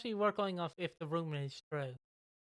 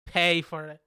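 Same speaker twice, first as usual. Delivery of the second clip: mock menace; 9 dB louder than usual. Imitating Nick menacing Lofty.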